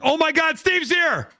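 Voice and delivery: high, thrilled announcement; excited. Real, not acted. Host announcing the winner just joined, high and thrilled. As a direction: shout it out, over the moon that he's here.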